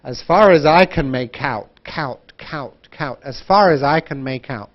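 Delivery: "make out" linked so that "out" starts with a k, "kout"; linked words. Shown as a demonstration. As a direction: drill the join in "as far as I can make out". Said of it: "link it together".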